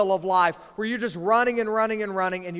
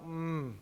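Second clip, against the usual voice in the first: savoring hum; 4 semitones lower, 12 dB quieter than usual. Acted. Savoring favorite food.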